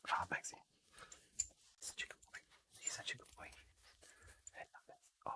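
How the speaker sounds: soft whispered praise to a dog; whispering. Real, not acted. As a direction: breathe it out, tender.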